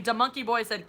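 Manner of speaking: ordinary talk, nothing marked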